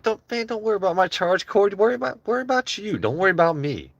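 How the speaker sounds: high, fast mocking squeak. Streamer answers chat's question in a high mocking squeak. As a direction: toss it back in a squeaky mock voice. Mocking reply about charge cord.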